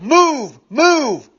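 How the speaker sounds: high, punchy barked command; retold yelling. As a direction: imitate an impatient cop yelling orders. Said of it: "he started yelling and screaming at".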